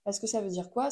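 usual speaking voice

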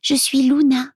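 high, lightly acted child voice; little girl answering. A narrator's reading. Girl introduces herself to Milo, high child voice. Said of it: "répond-elle".

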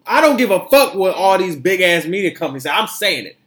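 loud, heated rant over the media; shouting it out defiantly. Real, not acted. Podcast host loudly ranting at big media companies.

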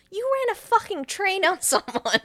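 high, loud indignation with a laugh creeping in; hammed-up shouted accusation; mock outraged accusation. Listener imagining confronting the man, loud mock outrage.

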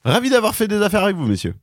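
high, quick trader voice; a chirpy merchant closing a deal. Acted. (voix de marchand aigüe et rapide)

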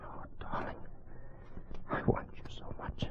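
hushed, urgent whisper of longing. Acted. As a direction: whisper it close to her ear. Lovers' embrace, hushed.

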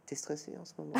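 lightly voiced amused luthier asking a question. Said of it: "un œil assez amusé".

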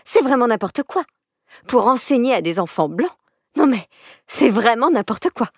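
put-on high, scornful spiteful girl; insolent scorn. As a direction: sneer at the idea. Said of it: "l'insolente lui coupa la parole".

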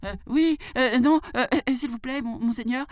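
very high, stammering mock fright; flustered jackal. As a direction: stammer high, torn between answers. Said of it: "faisant semblant de trembler de peur". (chacal affolé, voix aiguë qui bégaie)

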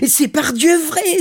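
high, loud, slow cry of delight; joyful exclamation. A narrator's reading. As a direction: exclaim it, overjoyed. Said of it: "s'écria l'armateur tout joyeux". (exclamation toute joyeuse)

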